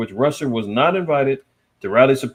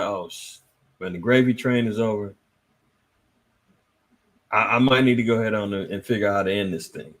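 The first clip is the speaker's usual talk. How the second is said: imitating Zelensky; 6 semitones lower than usual. Zelensky voiced in retold panic.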